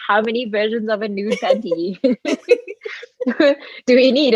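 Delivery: mock disbelief at endless products; half-laughing exasperated ask. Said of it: "you're just like".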